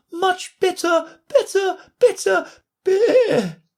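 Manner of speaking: climbs into a squeak then a sheep's bleat; Queen turning into a sheep mid-word; rising to a squeak. A narrator's reading. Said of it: "her voice rising to a squeak".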